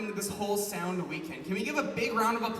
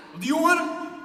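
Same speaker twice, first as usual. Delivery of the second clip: mock challenge; 13 dB louder, slower than usual. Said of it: "And he was just like".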